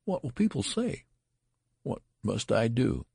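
high-pitched aghast questioning; high, horrified despair. A narrator's reading. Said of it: "in an ecstasy of horror".

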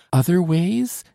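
high, rising astonished question; surprised exclamation. A narrator's reading. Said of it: "exclaimed Solange".

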